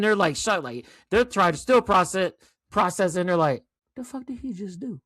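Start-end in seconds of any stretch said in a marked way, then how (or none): 3.9-5.0 stunned hushed disbelief. Retold or imitated. Crowd stunned by the moonwalk.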